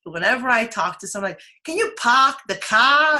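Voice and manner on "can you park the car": put-on higher, slow Boston accent with dropped Rs. Host imitating people met from Boston, Boston accent. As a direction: stretch it out as a mock Bostonian. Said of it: "let me tell you something about Boston".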